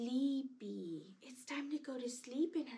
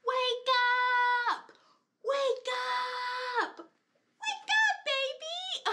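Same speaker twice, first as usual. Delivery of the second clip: high, loud wake-up shout; calling out; 10 semitones higher, 8 dB louder than usual. Shown as a demonstration. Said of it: "Let's say, "Wake up!".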